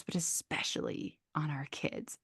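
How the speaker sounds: a soft whisper, almost no voice. Real, not acted. Mother whispering about her kids, tender whisper.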